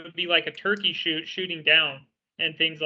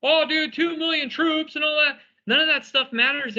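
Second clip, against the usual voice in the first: mock-impressed, high-pitched quote; put-on high voice of awed commenters; mocking overexcited commenters; 8 semitones higher than usual. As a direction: imitate hyped-up people in a higher voice. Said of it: "there's a lot of people like".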